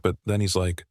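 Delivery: ordinary delivery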